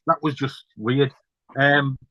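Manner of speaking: ordinary talk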